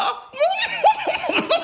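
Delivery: shouted cackle of delight. Acted. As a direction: burst out laughing at your own quip. Ben laughs at own joke.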